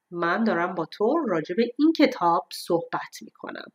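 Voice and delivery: ordinary talk, nothing marked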